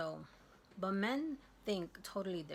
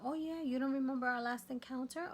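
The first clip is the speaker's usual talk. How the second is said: sarcastic retort; 7 semitones higher, 3 dB louder than usual. Retold or imitated.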